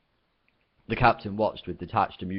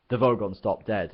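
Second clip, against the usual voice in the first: usual speaking voice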